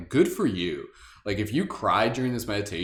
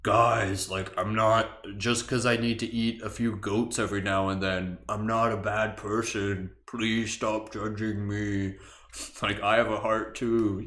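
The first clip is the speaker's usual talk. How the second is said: dragon voice; slower than usual. Pretend. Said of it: "It's like".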